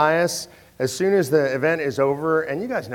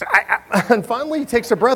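ordinary talk

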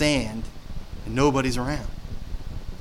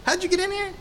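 high squeaky mock challenge; mock indignation; 4 dB quieter than usual. Preacher imagining confronting Job's friends, high mock indignation. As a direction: play-act the outraged host. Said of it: "That's what I, I would have felt like".

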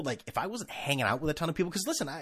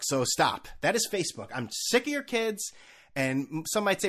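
usual speaking voice

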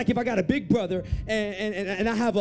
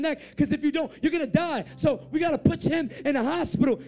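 higher, pressing, nonstop urgent orders; storming-in urgency; 4 semitones higher than usual. Retold or imitated. Second doctor storms into the room, urgent orders. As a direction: act out a doctor taking urgent charge. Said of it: "He stormed in the room".

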